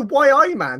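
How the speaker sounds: imitating a Geordie accent. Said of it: "did [name] just go".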